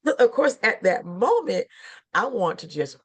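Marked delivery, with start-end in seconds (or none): none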